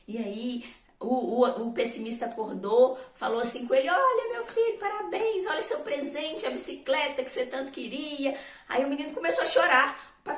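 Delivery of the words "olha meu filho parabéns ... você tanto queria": high, bright-voiced dad giving a gift; cheerful father. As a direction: play the proud father handing over the bike. Father hands son his present.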